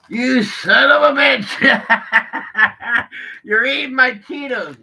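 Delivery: high, loud, slow yell breaking into laughter; laughing mock yell. Man retells yelling at the dog eating his Cheetos, laughing. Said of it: "opened up my eyes and went".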